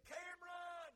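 loud high call of Cameron; calling a name. Acted. God calling the young boy, loud high call. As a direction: call him by name from far.

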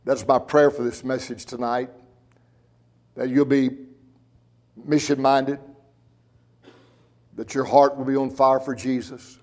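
hushed pastor's invitation. Acted. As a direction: draw the congregation in gently. Quiet altar-call invitation.

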